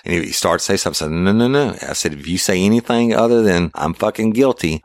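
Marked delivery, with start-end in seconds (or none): 1.1-1.8 high, quick repeated refusal; retold cutting off. Officer stopping suspect's excuse, retold sharp cut-off. 2.1-4.8 raised-pitch warning to a suspect; retold threat. Officer warning suspect to confess, retold threat.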